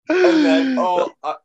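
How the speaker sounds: laughing so hard the words break up high; real helpless laughter cutting the words off; cry laughing. Real, not acted. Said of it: "all I could do was cry laughing".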